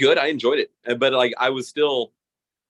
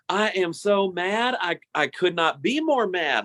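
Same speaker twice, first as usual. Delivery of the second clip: slow, overwrought mock line reading; a hint of a melodramatic actor; mocking stilted play lines; 6 semitones higher, slower than usual. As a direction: ham up a badly written stage line, slowly. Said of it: "they just repeated, like, every single line".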